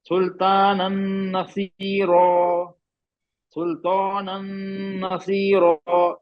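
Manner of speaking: "सुल्तानन नसीरा" slowly chanted with a held nasal n; idgham recitation. Shown as a demonstration. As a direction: merge the end of "सुल्तानन" into "नसीरा" with a long hum. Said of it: "ये भी इदगाम में से है".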